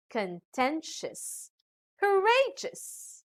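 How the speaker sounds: slow "contentious, courageous", weight on TEN and RA; stress before ending. Shown as a demonstration. Teacher showing rule four, "contentious, courageous" slowly stressed on the middle syllable. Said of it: "the syllable before these endings".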